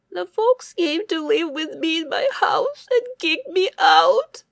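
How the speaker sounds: high, loud, sobbing hare; crying hare. Acted. Hare sobbing to the bear about his house, high and crying. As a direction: cry it out through tears. Hare cries to old man.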